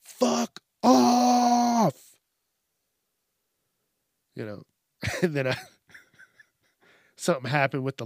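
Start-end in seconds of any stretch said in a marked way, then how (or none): 0.0-2.2 much louder and higher shouted Fuck off; yelling. Pretend. Yelling at a scam caller on a bad day. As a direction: bark it into the phone. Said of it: "I just yell into the phone".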